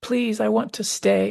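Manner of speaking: high, slow, aching plea; slow high-pitched begging to stay; Charlie's last plea. Retold or imitated. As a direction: plead slowly as the last chance slips away. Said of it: "and he says".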